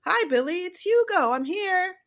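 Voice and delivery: high, loud call from outside. A narrator's reading. Hugo calling to Billy from outside, high and loud. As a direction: call through the door to a friend. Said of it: "he cried".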